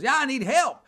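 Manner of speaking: high, insistent pleading voice; simple stranded traveler. Retold or imitated. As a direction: imitate a confused man needing help. Voicing the odd stranded traveler.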